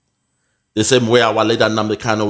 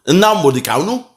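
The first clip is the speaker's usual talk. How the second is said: mock Yoruba accent; 6 semitones higher than usual. Commentator imitating how his opponent says the name, mock Yoruba accent. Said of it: "that Yoruba accent be that".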